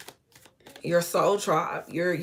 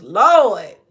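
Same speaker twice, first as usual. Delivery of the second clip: a sudden much louder "Lord"; overwhelmed cry; 11 dB louder than usual. Real, not acted. Reader getting chills, loudly calling Lord. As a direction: burst out with feeling. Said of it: "I just got chills".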